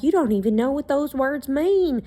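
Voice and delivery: mocking their voice. Mimicking people who doubt her words, a high mocking whine. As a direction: parrot them back scornfully. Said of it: "you're like".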